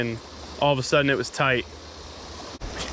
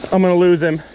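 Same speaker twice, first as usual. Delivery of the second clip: louder, panicked cry; 6 dB louder, faster than usual. Real, not acted. Angler about to lose the big fish, louder panic. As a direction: near shouting in alarm.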